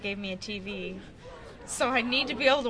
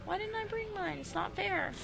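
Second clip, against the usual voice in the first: whiny; 12 semitones higher, 6 dB quieter than usual. Real, not acted. Wishing for own dogs.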